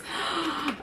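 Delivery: quiet sharp gasp of awe; hushed gasp of wonder; soft gasp. Real, not acted. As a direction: catch your breath at the sight. Opening the box.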